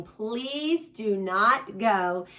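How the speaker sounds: slow, deliberate, weight on not; slow emphatic warning. Real, not acted. Host warning viewers off store bedroom sets, slow and emphatic. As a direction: stretch it out and lean hard on not. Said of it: "I want to stress to you".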